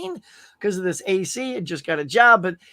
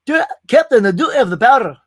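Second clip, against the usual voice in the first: loud, high engineer's cry to the captain; frantic ship's engineer, hammed up at full volume; Scotty; 4 semitones higher, 9 dB louder than usual. Ship's engineer answering the captain about power, hammed-up frantic shout. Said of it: "I'm gonna tell Scotty".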